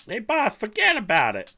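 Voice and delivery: hammed-up New York wiseguy, loud and high; big mock New York dismissal; bad New York accent. Worker brushing off the boss, put-on New York accent. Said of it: "like, New York, or I don't even know what accent".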